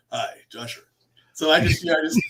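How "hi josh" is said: low, soft radio-man greeting; imitating Josh. Guest trying to do the host's voice, low soft imitation. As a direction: drop low and quiet to mimic him. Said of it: "I just try to do Jo".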